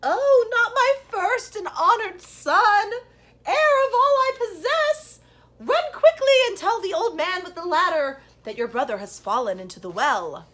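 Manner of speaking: mother alarmed for her eldest son. A narrator's reading. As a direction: cry out for your first son.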